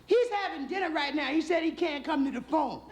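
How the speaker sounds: very high falsetto wife; comic housewife voice, way up high; wife relaying message. Acted. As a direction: pipe the message down the phone in falsetto. Wife relays husband's words in falsetto.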